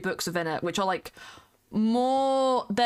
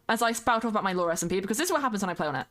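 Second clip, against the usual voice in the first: ordinary talk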